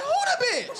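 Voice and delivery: sky-high, eager comic question; nosy onlooker. Acted. Someone popping out of the store to look, high nosy call. Store customer pops head out.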